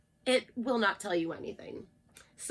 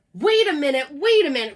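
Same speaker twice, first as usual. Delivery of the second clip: loud urgent call; cried out; 4 semitones higher, 8 dB louder, faster than usual. Acted. Narrator Jack interrupts the birds, loud urgent cry. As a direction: stop them with a loud cry. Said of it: "cried Jack the narrator".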